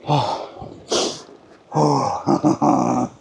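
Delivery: winded puffing after the climb; breathless gasps. Real, not acted. Panting out of breath after walking up the killer hill. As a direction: gasp for air, out of breath from the hill. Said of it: "I'm out of breath now".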